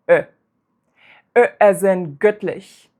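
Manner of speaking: short open lax Ö in göttlich. Shown as a demonstration. Teacher demonstrating the short lax Ö sound. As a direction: clip the Ö short and loose. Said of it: "It sounds like this".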